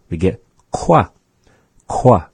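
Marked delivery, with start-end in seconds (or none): 1.9-2.3 "qua" with the kw of queen, higher and louder; rounded QUA. Shown as a demonstration. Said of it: "as in queen".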